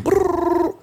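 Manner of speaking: high trilled phone ring; imitated phone ring. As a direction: roll a high brrr like a ringtone. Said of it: "I hear the phone ringing".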